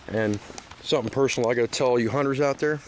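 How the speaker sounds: normal talking voice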